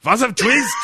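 high, loud mock German question; mock German accent. Comic twist reveal in a hammy German accent. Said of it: "maybe German?".

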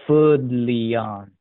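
roach man voice. A narrator's reading.

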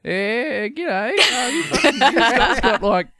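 high, slow, put-on broad Australian; broad Australian at a bar. Ordering at an American bar in broad Australian. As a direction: lay on the ocker Australian accent. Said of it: "go up to a bar and be like".

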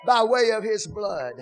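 loud, ringing preacher's cry over the music; full-force preaching. Acted. Preacher urging the church to speak to their souls, loud and fervent. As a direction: whoop it up like a preacher stirring the house.

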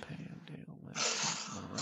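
breathy unvoiced repeat of the name; whispered echo. Friend whispers back the silly name, in a whisper.

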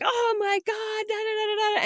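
mock frantic upset. Wife imitating husband after the game ends, mock meltdown. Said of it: "going crazy because he's so upset".

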